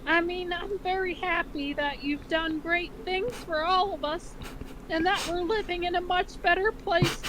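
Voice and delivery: hammed-up cartoon mom reassuring her son; mother's voice. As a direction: read Ma a pitch up with a motherly lilt. Reads Ma's dialogue.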